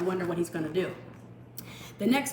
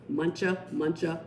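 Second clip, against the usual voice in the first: ordinary delivery, nothing marked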